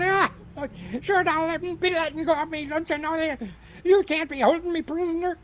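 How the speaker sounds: high, loud Irish leprechaun protest; squealing leprechaun. Acted. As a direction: squeal like a caught little leprechaun. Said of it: "The leprechaun's kicking and screaming".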